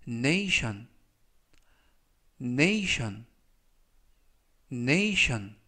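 nation said clearly three times, with pauses to repeat; careful pronunciation demo. Shown as a demonstration. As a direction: model nation for learners. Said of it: "이라고 발음하시면 되겠습니다". (nation을 또박또박 세 번 발음 시범)

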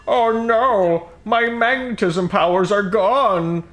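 high, slow mock dismay; Magneto dismay. Retelling Magneto losing his powers, hammed-up high whine. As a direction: whine like a beaten cartoon villain. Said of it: "He's like".

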